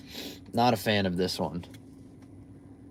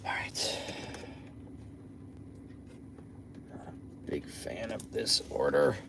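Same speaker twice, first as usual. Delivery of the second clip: whispering; 8 semitones lower, 14 dB quieter, slower than usual. Real, not acted. Quiet sarcastic aside, unvoiced.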